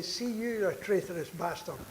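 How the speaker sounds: ordinary talk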